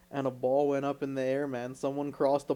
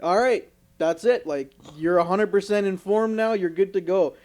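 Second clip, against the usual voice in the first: louder, higher announcer voice; captain announcing; 4 semitones higher, 6 dB louder than usual. Retold or imitated. Captain finishing the safety meeting, a louder announcer voice. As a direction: wrap up like a captain on a speaker. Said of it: "hear the captain being like".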